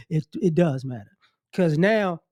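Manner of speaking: usual speaking voice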